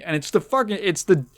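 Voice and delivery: normal talking voice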